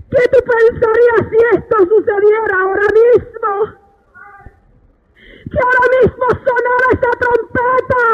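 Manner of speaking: high, tearful sustained exhortation; fervent preaching. Real, not acted. Preacher urges hermanos to humble themselves, high tearful preaching. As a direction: plead with the congregation in a wailing chant.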